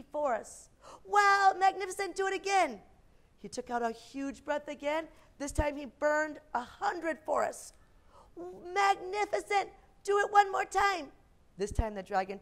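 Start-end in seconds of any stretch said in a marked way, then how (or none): 1.0-2.8 high, loud, gushing wow; gushing praise. Acted. Queen eggs on the dragon, gushing excitedly. Queen gushes over fire. 8.3-11.1 high, loud, gushing wow; gushing praise. Acted. Queen eggs on the dragon, gushing excitedly.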